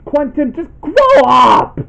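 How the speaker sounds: shouting. Real, not acted. Scolding Quentin directly.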